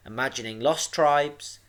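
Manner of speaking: plain reading voice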